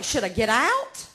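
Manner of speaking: ordinary delivery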